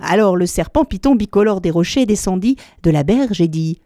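ordinary delivery, nothing marked

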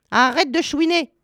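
high-pitched, cutting rebuke; scolding. A narrator's reading. Old witch telling Gretel to stop whining, scolding. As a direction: shut down the crying in a high voice.

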